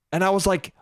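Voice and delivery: normal talking voice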